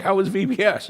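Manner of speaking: high, loud breezy greeting; a wife bouncing in with a bright question; wife's cheery question. Retold or imitated. Wife greeting her husband at the door, high and loud.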